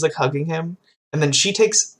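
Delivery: normal talking voice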